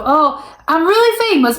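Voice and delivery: a hint of a self-satisfied star bragging; boastful artist. Vlogger mimicking an artist who thinks they're famous, lightly smug.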